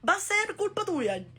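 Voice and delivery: sulky dramatic friend. Retold or imitated. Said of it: "Él dice".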